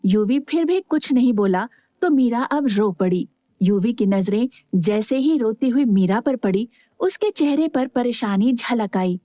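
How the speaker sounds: ordinary delivery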